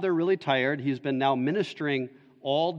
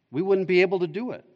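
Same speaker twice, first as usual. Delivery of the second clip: fast and higher, tumbling out; hurried defeat; 5 semitones higher, 3 dB louder, faster than usual. Retold or imitated. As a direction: rush the hopeless conclusion. Disciples conclude it is impossible.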